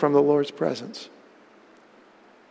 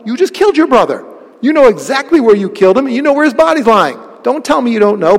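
accusing; 5 semitones higher, 5 dB louder, faster than usual. Acted. Preacher confronting Cain directly.

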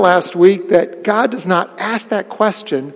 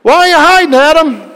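high, loud calling question; loud high call of God in the garden; God calling Adam; 9 semitones higher, 8 dB louder than usual. Retold or imitated. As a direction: call out to the hiding man, louder and higher. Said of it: "they say acorns don't fall".

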